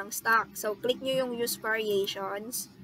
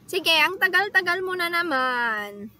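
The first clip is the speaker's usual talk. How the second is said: higher, louder grumble at the delay; exasperated complaint; 6 semitones higher, 5 dB louder than usual. Real, not acted. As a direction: complain loudly at a stalling machine. Grumbling at a slow-loading website.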